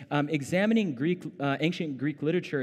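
normal talking voice

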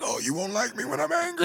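high laughing mock threat; mock Hulk voice. Quoting Hulk line.

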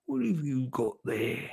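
soft, hushed character question; gentle. Acted. Mister Thompson crouches down to the children, gentle soft voice. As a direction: ask it very softly. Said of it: "he said gently".

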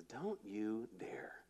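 slow, hushed warning whisper; stern whisper. Retold or imitated. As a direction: breathe it as a quiet, firm rebuke. Jesus rebukes angry disciples.

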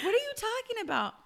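high, baffled retold question; confused. Daughter asking mom what she means, confused. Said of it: "so confused".

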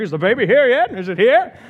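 high, loud, eager mock dad; a pacing TV dad asking for news; hammed-up eager father at the hospital; impatient expectant father. TV father pacing waiting room.

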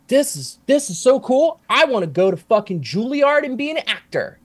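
put-on high-pitched, thrilled kid voice; excited seven-year-old self. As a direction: imitate a child bursting with a new ambition. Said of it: "is what I said when I was seven years old".

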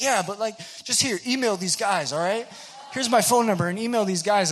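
high, loud, booming friendly dude; loudest guy ever. Retold or imitated. Kevin Earnest inviting the newcomer, booming and friendly. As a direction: greet a stranger like a long-lost buddy at full volume. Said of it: "the loudest human being I've ever known".

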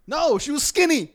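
loud, high, emphatic denial; indignant protest. Real, not acted. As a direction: burst out in outraged correction. Indignant answer to her question.

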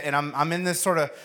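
ordinary talk

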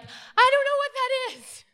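high, loud squeal of alarm; hammed-up freaked-out shout; panicked mock yell. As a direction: yelp it like someone fleeing in a fright. Acts out someone running away alarmed.

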